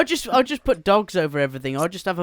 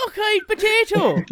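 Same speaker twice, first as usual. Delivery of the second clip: high, drawn-out mock Irish; hammed-up Irish caricature, pitched way up. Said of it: "Doesn't sound very Irish".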